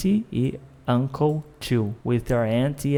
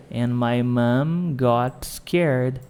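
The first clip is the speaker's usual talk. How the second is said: slow, careful practice line; slow drill; much slower than usual. Shown as a demonstration. Teacher drilling a student, slow and careful. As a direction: say it slowly for the student to copy. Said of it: "vamos falar scared".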